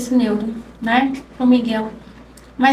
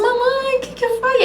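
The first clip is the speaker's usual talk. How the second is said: voz aguda de criança chamando a mãe; menininho preocupado; 9 semitones higher than usual. Retold or imitated. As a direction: imite um garotinho, bem mais agudo. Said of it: "o meu menininho do meio". (menininho perguntando à mãe)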